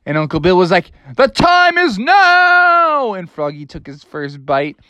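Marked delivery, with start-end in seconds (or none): 1.2-3.1 high, slow, stretched-out pronouncement; grand proclamation. A narrator's reading. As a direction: announce it like a herald. Uncle Bill's grand announcement.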